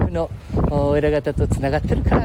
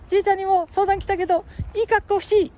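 put-on high squeaky boy voice; cheeky little kid, much higher; Ken-chan voice; 3 dB louder than usual. Ken-chan echoes grandpa.

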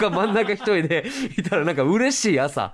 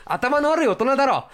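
higher, teasing jab from the back; mock heckle; 9 semitones higher than usual. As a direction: tease him from the back row. Retold heckle repeated.

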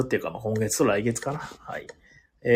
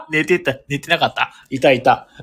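real laughter breaking through the words, louder and higher; laughing; 4 semitones higher, 8 dB louder than usual. Real, not acted. Host finds the viewer thought asleep is still there, laughing.

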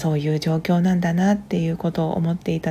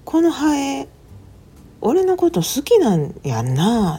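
put-on higher, loud, slow boyish voice; child voice; 6 semitones higher, 5 dB louder, slower than usual. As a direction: voice the little boy, delighted. Younger son quoted in story.

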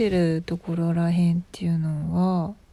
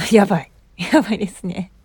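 louder, laughing through the words; 7 dB louder, faster than usual. Real, not acted. Host laughing about her forgetful brain to listeners, laughing. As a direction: laugh at your own aging brain.

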